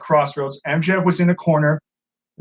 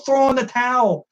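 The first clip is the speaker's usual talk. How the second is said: high, urgent plea; pleading fan cry; 7 semitones higher than usual. Retold or imitated. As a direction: beg him not to throw it.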